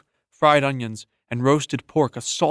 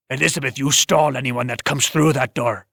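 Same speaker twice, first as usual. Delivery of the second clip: gruff tavern owner. Acted. Finn gives orders.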